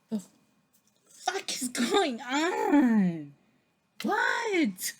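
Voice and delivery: high, slow, half-crying disbelief; laughing-crying what-the-heck; incredulous. Real, not acted. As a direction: wail it out in shock. Shocked by tarot card.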